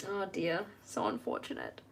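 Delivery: usual speaking voice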